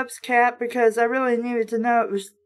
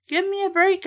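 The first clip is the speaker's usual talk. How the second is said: cried out; 8 semitones higher, faster than usual. A narrator's reading. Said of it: "I cried".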